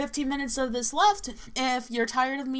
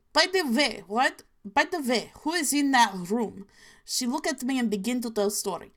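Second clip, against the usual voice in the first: put-on inaccurate Russian accent. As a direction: fake Russian accent, spooky and matter-of-fact. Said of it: "inaccurate Russian accent".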